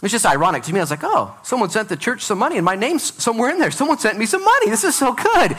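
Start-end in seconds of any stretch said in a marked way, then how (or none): 1.0-5.6 high, delighted surprise at found money; excited. Retold or imitated. Pastor acting his own thrilled surprise at the mail, high and eager. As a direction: gush like someone just got paid.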